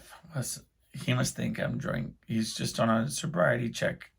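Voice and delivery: whispered curse, then hushed worry; panicked whisper. Retold or imitated. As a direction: mutter it under your breath in panic. Panicked whisper to himself in car.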